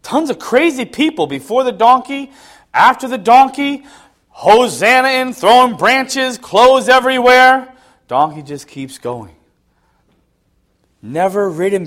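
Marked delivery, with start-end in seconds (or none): 0.0-7.7 high, loud, rousing list; excited build-up. Real, not acted. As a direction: pile up the chaos in a raised, ringing voice. Preacher painting the frenzied crowd scene.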